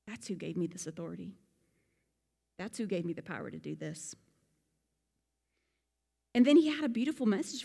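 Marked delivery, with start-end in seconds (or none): 0.0-4.2 softer, lower, settled; quiet conviction. Retold or imitated. Peter ending his answer to the rulers, quietly. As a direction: drop it down with certainty.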